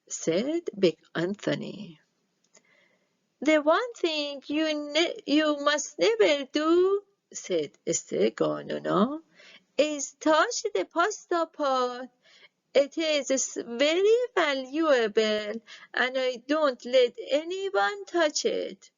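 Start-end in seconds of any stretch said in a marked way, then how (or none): 3.4-7.0 put-on old witch giving orders. Strega Nona telling Big Anthony his chores in a higher, measured old-woman voice. 9.8-18.9 put-on old witch giving orders. Strega Nona telling Big Anthony his chores in a higher, measured old-woman voice.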